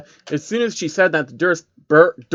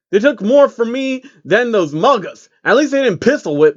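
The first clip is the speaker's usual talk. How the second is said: higher, louder tough-guy voice; gruff inner thought; 8 semitones higher, 4 dB louder than usual. Acted. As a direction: grumble it like a cocky prisoner. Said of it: "Takeshi thought".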